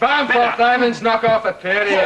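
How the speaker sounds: high, loud gloating over the beaten soldier; mock proclamation. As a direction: crow it like a champion.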